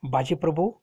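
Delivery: usual speaking voice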